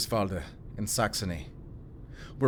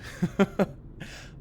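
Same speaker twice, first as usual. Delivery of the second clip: airy sheepish giggle, pitched up; breathy giggle; 11 semitones higher than usual. Acted. After confessing fib.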